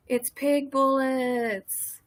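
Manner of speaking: very slow, loud playful call; drawn-out announcement. Pretend. Streamer hailing Pig Bullets in chat, long drawn-out call.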